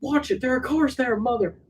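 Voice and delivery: high, hammed-up snap at kids; comic high-pitched scolding; mock angry warning. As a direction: scold the children in a cartoon high voice. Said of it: "He goes".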